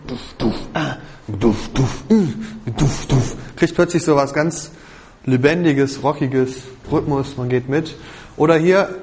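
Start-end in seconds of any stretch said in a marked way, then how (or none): 0.1-3.4 low thudding vocal kick drum; low vocal drum, duf duf; driving bass drum imitation. Shown as a demonstration. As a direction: thump out the driving beat. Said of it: "aber eine treibende Basstrommel".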